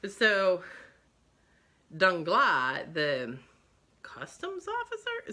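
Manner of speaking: ordinary talk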